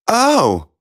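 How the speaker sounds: high surprised princess; a high startled oh. Acted. Princess caught out.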